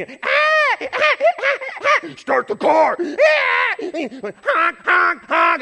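high screaming meltdown, laughing through it; frantic shout. Retold or imitated. Stranded driver snapping at honking traffic, shrieking. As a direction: lose it completely, shrieking.

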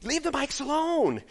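high-pitched panicked yell; frantic retold shout. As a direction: shout frantically at kids to stop. Retold yelling at kids near mics.

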